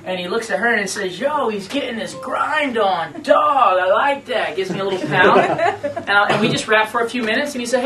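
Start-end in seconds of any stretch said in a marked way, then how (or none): 1.2-4.6 quoted Randy, loud and thrilled; excited shout. Retold or imitated. Randy cheering the singer's hustle, excited shout. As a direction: shout it out, slow and pumped.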